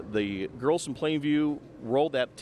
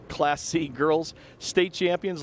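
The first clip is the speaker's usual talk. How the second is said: breathless sideline reporter; 3 semitones higher, much slower than usual. Real, not acted. Sideline reporter crowns the champions.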